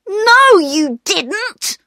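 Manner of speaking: put-on very high, indignant child's denial; angry protest. A narrator's reading. Peter contradicting his sisters' confession, high angry protest. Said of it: "said Peter angrily".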